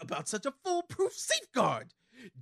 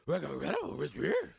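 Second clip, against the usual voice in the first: low garbled dog voice; cartoon dog voice; 8 semitones lower than usual. Scooby dog voice.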